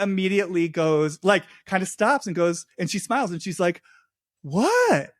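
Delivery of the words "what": high-pitched mock-innocent voice; put-on clueless act, voice lifted high; feigned innocence. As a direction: play dumb, imitating someone caught out. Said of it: "she doesn't know what he's talking about".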